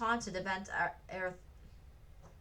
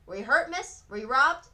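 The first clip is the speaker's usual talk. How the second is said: policeman; 5 semitones higher, 10 dB louder than usual. A narrator's reading. Policeman asking Frances.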